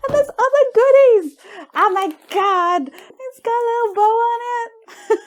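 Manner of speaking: high, loud, sing-song delight; squealing glee. Real, not acted. Finding extra gifts, high sing-song squeal. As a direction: sing out your joy at the find.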